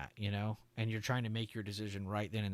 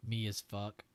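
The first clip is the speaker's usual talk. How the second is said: ordinary talk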